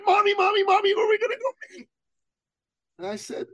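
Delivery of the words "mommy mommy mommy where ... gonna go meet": very high-pitched imitation of an eager child; excited little boy. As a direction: pester mom like a thrilled small boy. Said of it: "in that excited way little boys do".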